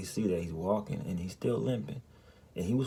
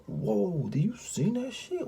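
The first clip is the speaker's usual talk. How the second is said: high, slow, amazed whoa; to wife, high amazed whoa; 12 semitones higher, slower than usual. Retold or imitated.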